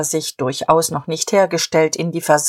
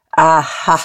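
a short burst of huffy suspicion; snorted scorn; 4 dB louder than usual. A narrator's reading. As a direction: snort it out indignantly. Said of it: "Diederich schnob".

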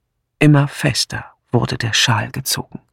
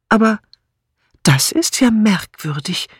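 higher, puzzled outburst; sudden exclamation; 7 semitones higher than usual. A narrator's reading. Amy struck by something odd, blurting it out. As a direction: blurt it as it dawns on you. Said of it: "stieß sie auf einmal hervor".

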